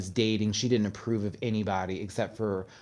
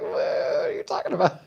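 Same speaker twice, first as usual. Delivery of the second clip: laughing disbelief; much faster than usual. Retold or imitated. Shocked at mom's coming-out talk.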